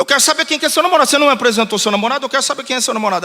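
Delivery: fast, high-pitched insistent questioning; mock interrogation. Pastor demanding to meet the girl's boyfriend, mock scolding. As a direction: pester her like a protective father.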